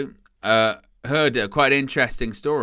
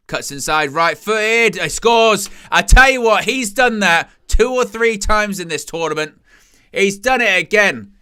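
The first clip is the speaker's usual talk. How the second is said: rising to a loud, high goal call; excited commentary; 7 semitones higher, 7 dB louder than usual. Real, not acted. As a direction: call the goal like it's live.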